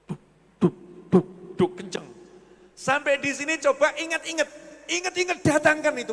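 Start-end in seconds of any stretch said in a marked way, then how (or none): 0.6-2.0 thudding heartbeat noise, duk duk; heart pounding. Retold or imitated. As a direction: mimic a racing heart with low thumps. Pounding heart from remembered debt.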